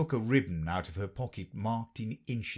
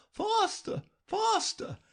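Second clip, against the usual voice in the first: queen crying out; slower than usual. A narrator's reading. Queen urges Alice while running, high mild cry. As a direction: hurry her along in a high queen voice. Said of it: "and still the queen cried".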